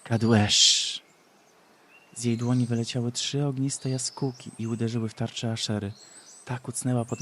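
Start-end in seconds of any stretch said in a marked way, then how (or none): 0.0-1.0 loud spell. Acted. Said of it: "powiedziała donośnym głosem".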